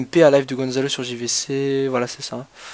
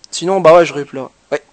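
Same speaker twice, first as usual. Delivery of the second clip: ordinary talk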